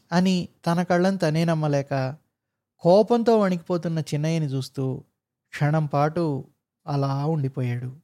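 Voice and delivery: ordinary delivery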